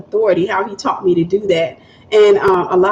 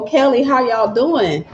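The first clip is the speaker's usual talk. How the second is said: ordinary talk, nothing marked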